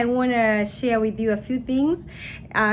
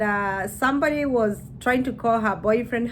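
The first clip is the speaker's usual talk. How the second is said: usual speaking voice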